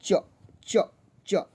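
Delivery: "च" said as a bare consonant with no vowel after it; half च. Shown as a demonstration. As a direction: clip "च" short with no अ sound after it. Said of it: "इसको हम लोग पढ़ेंगे क्या?". ("च" आधा, बिना अ के बोलना)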